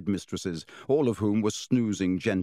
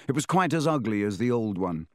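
ordinary delivery

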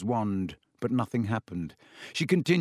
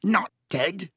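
high, choked disbelief; 6 semitones higher than usual. A narrator's reading. Caretaker choking out hope over his cat, high and choked. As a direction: catch in the throat on the question. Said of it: "choked Filch".

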